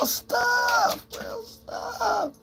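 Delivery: very high, soft "Real stuff"; high catchphrase. Real, not acted. Signature catchphrase.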